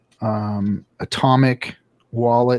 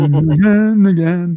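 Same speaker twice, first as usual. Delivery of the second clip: mock echo; 5 semitones higher, 5 dB louder than usual. Repeating in put-on voice.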